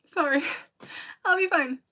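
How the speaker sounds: trying not to cry while reassuring chat; holding back tears. Real, not acted. Streamer telling viewers she'll be fine, close to tears. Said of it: "I don't wanna cry".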